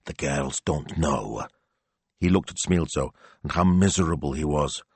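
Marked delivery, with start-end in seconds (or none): 0.1-1.4 hushed. Acted. Hiding news from the girls.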